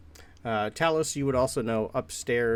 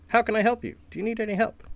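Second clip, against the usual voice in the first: high, stiff helper-bot voice; louder, pitched-up construct on a loop; repetitive robot; 11 semitones higher, 4 dB louder, much faster than usual. Acted. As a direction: rattle off canned robot offers of help. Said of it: "Just over and over".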